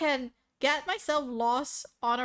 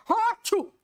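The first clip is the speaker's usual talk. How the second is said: higher, louder mock sneeze; imitated loud sneeze; 9 semitones higher, 7 dB louder than usual. Shown as a demonstration. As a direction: blast out a fake sneeze. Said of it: "is that how you sound like?".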